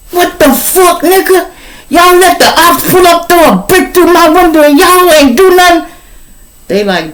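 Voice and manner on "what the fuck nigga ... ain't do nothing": very high, loud, strained bellow; furious general yelling. Acted. General screaming at his soldiers over the broken window. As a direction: blow up at the crew who let it happen.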